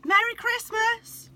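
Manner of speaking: shouted. A narrator's reading. Molly shouting the signal to Santa, a loud high yell. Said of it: "she shouted".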